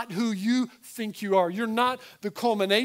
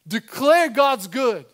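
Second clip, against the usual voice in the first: declaring; 3 semitones higher, 9 dB louder, slower than usual. Acted. Preacher describing forcing himself to worship.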